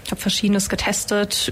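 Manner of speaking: ordinary talk, nothing marked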